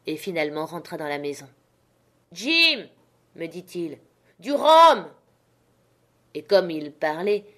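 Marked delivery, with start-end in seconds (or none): 2.3-2.9 calling out. A narrator's reading. Captain calls for Jim. 4.4-5.1 loud, high bark of a demand; demanding rum. A narrator's reading. Wounded captain yelling at Jim for rum, loud demand. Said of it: "répéta-t-il".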